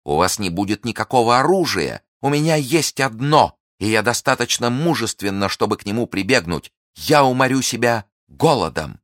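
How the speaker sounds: high, loud impassioned vow; fierce defiance. Acted. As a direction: hurl the vow at him.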